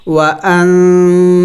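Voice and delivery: وانزل with the ن hidden in the nose, drawn out very slowly; very slow وانزل with a nasal hidden nun; nasal ikhfa. Shown as a demonstration. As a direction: hum the ن of وانزل through the nose, stretched. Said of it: "یعنی ناک میں آواز چھپانا ہوگا".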